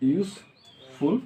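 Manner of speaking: Useful stretched very slowly, syllable by syllable; slow dictation to the class. Shown as a demonstration. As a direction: draw out useful as if writing it on the board. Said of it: "useful উচ্চারণ হচ্ছে দুইবার".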